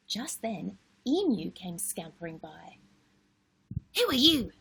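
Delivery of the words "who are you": sharp, loud demand; snapped question. A narrator's reading. Emu snapping at Wombat, a sharp loud demand. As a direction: snap it at the stranger. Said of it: "he snapped".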